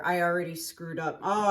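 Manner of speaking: ordinary talk, nothing marked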